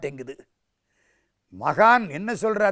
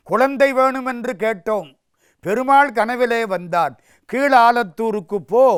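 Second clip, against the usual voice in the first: high, loud retelling of a divine dream; fervent proclamation; 6 semitones higher, 6 dB louder than usual. Acted. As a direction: declare the god's order with force. Devotee relays god's command.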